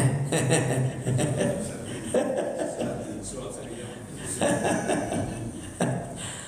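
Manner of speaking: long quiet laugh; real laughter at a police story; breathy laugh. Real, not acted. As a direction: laugh at the joke. Laughs at own joke.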